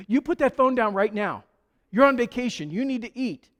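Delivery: a stern hostess ordering her guest off the phone; bossy hostess. Retold or imitated. Hostess to pastor on phone, stern scolding order. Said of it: "and she goes".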